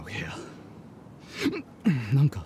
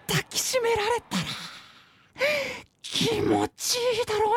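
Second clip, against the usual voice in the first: dreamy swooning; 4 dB quieter than usual. Acted. Dog daydreams of cuddle.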